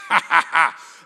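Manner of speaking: loud mocking dog laugh. The dog mocks the preacher with a loud laugh. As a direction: belt out a big cartoon dog laugh. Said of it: "the dog was like".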